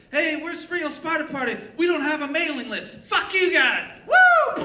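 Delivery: very high, loud sign-off, building to a whoop; mock frontman shout. Fake band singer ending every song, hammed-up shouting. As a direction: yell it out to the whole crowd.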